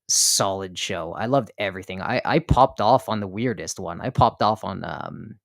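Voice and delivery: usual speaking voice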